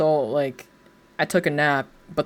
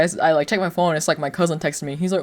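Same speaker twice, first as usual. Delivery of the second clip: usual speaking voice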